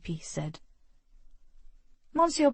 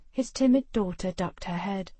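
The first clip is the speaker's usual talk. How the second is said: normal talking voice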